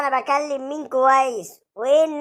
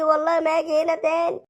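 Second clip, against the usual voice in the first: ordinary talk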